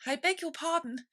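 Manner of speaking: humbly. A narrator's reading. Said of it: "said Alice very humbly".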